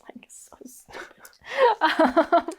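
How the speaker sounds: slow, whispery aside with laughter around it; whispered self-mockery. Real, not acted. Storyteller giving up on own sentence, amused whisper. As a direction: whisper it, about to crack up.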